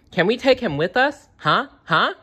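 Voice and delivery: high chirpy little duckbill; bright little child naming her pet; Ducky voice. A narrator's reading. Ducky pleading.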